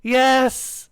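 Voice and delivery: high, loud single cry; long, emphatic shout; crowd yelling. A narrator's reading. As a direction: yell it back eagerly. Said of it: "the crowd yelled".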